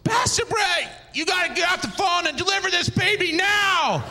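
high, panicked mock shout; frantic assistant's high-pitched holler; urgent call. Assistant yells for urgent help.

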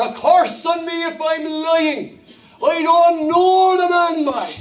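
high, drawn-out Cork oath; Cork accent. Acted. Peter swearing he does not know Jesus, slow Cork accent. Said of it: "let's say Peter's from Cork".

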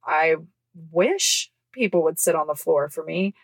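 dry sarcasm, stretched out on "wish"; sarcastic. Retold or imitated. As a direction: draw out a mock wish sarcastically. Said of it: "Sarcastically, obviously, guys".

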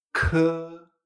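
k with a strong puff of air before the vowel; aspirated K. Shown as a demonstration. Teacher showing the Chinese K, k heavily aspirated. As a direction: release air on k, then hold the vowel. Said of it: "tenemos que sacar aire".

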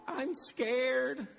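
put-on high little voice of a frightened child; imitated scared child. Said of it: "Karis is in tears".

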